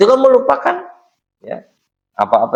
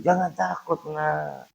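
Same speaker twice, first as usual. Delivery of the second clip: put-on soft, high whisper to a scared child; whispered comfort; 6 semitones higher, 12 dB quieter, slower than usual. Mother whispers to her son before circumcision, softly. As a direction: hush the boy gently. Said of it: "sambil dibisiki".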